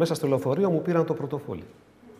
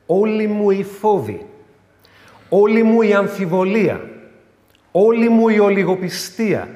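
fervent preaching; 6 semitones higher, 6 dB louder than usual. Acted. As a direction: pour out every fear and doubt. Preaching at full force.

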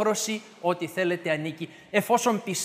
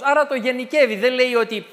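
ordinary talk, nothing marked

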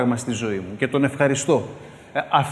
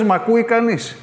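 calling out; 6 semitones higher than usual. Retold or imitated. Calling across the street.

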